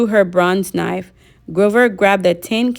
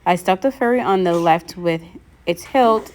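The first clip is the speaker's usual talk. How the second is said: plain reading voice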